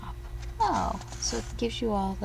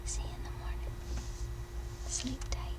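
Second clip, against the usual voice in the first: pillow talk; 6 semitones higher, 6 dB quieter, faster than usual. Real, not acted. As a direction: gently settle a little one to sleep. Bedtime talk to child.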